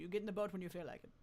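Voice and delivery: ordinary talk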